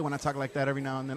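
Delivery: usual speaking voice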